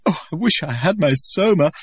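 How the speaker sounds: high, loud sobbing plea; tearful longing. A narrator's reading. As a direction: beg for it through tears. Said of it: "Lenina was still sobbing".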